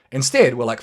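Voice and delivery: ordinary talk, nothing marked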